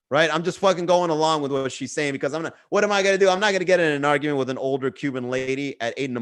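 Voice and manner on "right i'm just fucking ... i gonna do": ordinary talk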